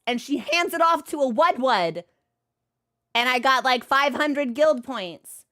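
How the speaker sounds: ordinary talk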